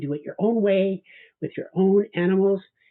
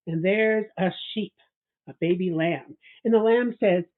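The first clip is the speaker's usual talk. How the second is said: plain reading voice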